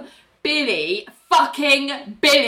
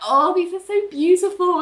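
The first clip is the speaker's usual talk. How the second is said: high-pitched, delighted gushing; smiling gush; 10 semitones higher than usual. Retold or imitated. Woman gushing over flowers, high smiling coo. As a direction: gush through a huge smile. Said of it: "with this massive smile on her face".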